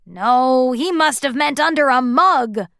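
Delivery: a grouchy dwarf setting them straight; grumpy dwarf voice. A narrator's reading. Grumpy corrects.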